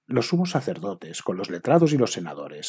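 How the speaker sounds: ordinary delivery, nothing marked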